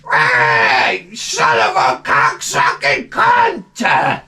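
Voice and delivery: drawn-out slow parrot screech; squawking cockatoo. Retold or imitated. Comic imitating the caged cockatoo again, slow squawk. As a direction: stretch the curse out like a squawking bird. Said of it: "Be sitting in the cage up there like this".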